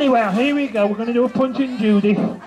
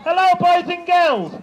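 panto callback; 6 semitones higher, 3 dB louder than usual. Shown as a demonstration. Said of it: "So when I say".

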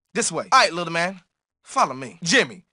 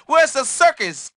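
normal talking voice

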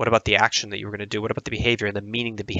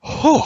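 relieved whew; 5 dB louder than usual. Real, not acted. Relief after long answer.

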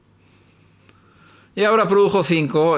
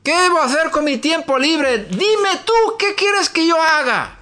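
raised, pleading prayer voice, pitched up; earnest prayer; 10 semitones higher than usual. Acted. Preacher models a surrendering prayer, pleading and high. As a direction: pour yourself out to God. Prays aloud, surrendering to God.

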